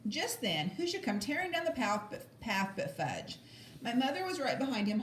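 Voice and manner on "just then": ordinary delivery, nothing marked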